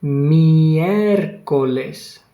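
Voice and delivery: Miércoles said very slowly, every syllable clear; Miércoles drawn out for dictation, each syllable spaced. Shown as a demonstration. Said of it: "let's see if you can write it properly".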